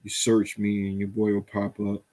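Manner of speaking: ordinary talk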